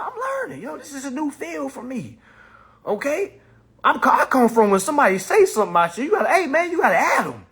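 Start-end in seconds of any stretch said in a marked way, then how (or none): none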